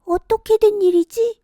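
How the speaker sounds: put-on high, bright puzzled girl's voice. Kongjwi puzzling over the jar, high girl's voice. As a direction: wonder aloud like a young girl.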